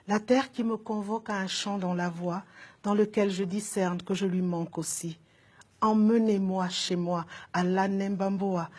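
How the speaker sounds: measured, lyrical poetry reading; poem recitation. Acted. Poet reading her longing for home to an audience, lyrical recitation. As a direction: recite each image with deliberate phrasing.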